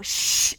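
loud hushing shh; librarian's shush. Pretend. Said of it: "I'm being that librarian".

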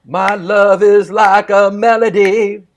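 slow Scottish burr, drawn out; slow, lilting Scottish recital; Scottish accent. Shown as a demonstration. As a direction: roll it out like a Scots bard. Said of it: "Scottish burr".

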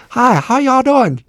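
high, slow, drawn-out Oklahoma twang; Oklahoma accent. Retold or imitated. Restaurant staff greeting a table, hammed-up Oklahoma accent. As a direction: greet diners with a big put-on Oklahoma accent. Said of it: "learn to speak English in Oklahoma".

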